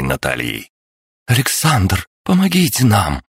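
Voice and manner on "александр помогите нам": high desperate call for help; crying out. A narrator's reading. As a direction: cry out for help. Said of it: "выкрикнула девушка".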